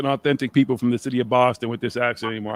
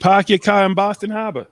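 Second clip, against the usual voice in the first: higher-pitched, dropped-R Boston accent; 5 semitones higher than usual. Shown as a demonstration. Showing off his accent after an objection, Boston accent. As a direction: lay on the Boston vowels. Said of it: "I'll show off my accent".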